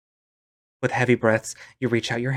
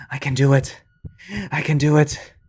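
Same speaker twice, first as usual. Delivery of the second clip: desperate; 4 semitones higher, 4 dB louder than usual. Acted. Panicked self-encouragement.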